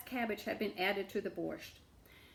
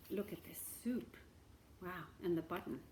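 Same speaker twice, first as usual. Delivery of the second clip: soft aside to the listeners; quiet aside; 3 semitones lower, 10 dB quieter than usual. Real, not acted. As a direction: murmur it over the picture. Aside about the picture.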